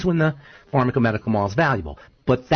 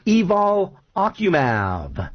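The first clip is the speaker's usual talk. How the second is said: drawn-out, slow product name, higher; mock ad announcer; 6 semitones higher, much slower than usual. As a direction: savor the drug name like a pitchman. Quoting a drug ad.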